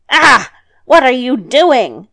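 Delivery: loud, indignant outburst; angry cry. A narrator's reading. Devil to grandmother at the second hair, angry. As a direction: cry out, then demand crossly. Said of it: "cried the devil angrily".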